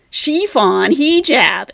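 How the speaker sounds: Chiffon, hijab said loudly as SHEE-fon, HEE-jab; mock a learner stressing first syllables in Chiffon and hijab; exaggerated SHEE and HEE in Chiffon, hijab; mispronunciations. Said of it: "it's all incorrect".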